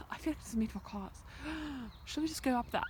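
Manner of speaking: usual speaking voice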